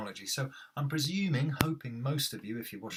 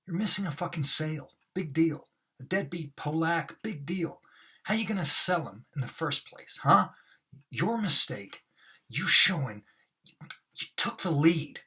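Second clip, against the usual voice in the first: higher, louder scornful rant; overacted Moss; 6 semitones higher, 7 dB louder than usual. Shown as a demonstration. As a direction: ham up the scolding. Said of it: "I really overact them".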